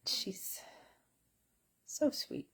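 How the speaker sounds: unvoiced, high soft whisper; whispered coo. Real, not acted. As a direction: whisper fondly so as not to wake her. Cooing over sleeping dog.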